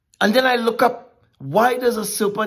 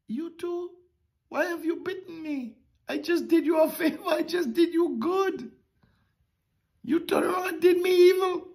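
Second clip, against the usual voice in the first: much softer, a faint plea with little breath left; dying breath; 7 semitones higher, 4 dB quieter than usual. Retold or imitated. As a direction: plead weakly as the dying frog. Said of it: "asked in his dying breath".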